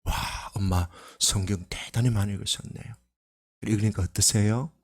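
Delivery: softly, lightly admiring; praising mother. Retold or imitated. Son complimenting his mother, soft admiring tone. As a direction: praise her gently. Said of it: "어머니한테 항상 칭찬해요".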